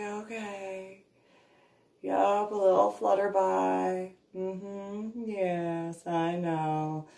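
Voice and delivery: soft, indulgent reassurance; soothing dog. Retold or imitated. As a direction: humor the scared dog. Calming scared dog.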